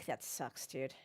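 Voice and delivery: low, soft groan of sympathy; commiserating. Real, not acted. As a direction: sigh it out in sympathy. Reacting to a teammate's penalty.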